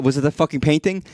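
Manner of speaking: ordinary talk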